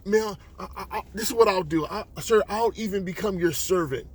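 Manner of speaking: high, urgent bargaining; desperate stammered plea. Acted. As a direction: stumble over words while begging. Mother offers herself as servant.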